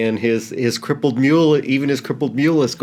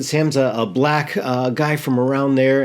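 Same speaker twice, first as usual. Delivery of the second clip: normal talking voice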